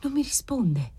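soft, high, whispery reply; hushed, entranced and remote; faraway voice. A narrator's reading. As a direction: sound as if from a great distance. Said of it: "quella voce che pareva venisse da una profonda lontananza".

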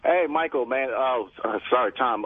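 ordinary talk, nothing marked

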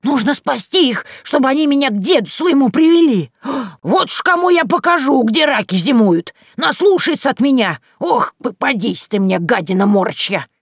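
high, loud, threatening crone voice; vengeful old witch. Acted. Yaga plots revenge on the grandfather, high and loud. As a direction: relish the revenge. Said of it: "Глаза Яги загорелись".

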